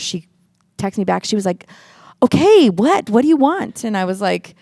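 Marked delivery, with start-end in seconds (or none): 2.2-3.8 high, louder exasperated snap; mock irritated sister brushing her off; annoyed sister. Sister texting back, mock annoyed and high.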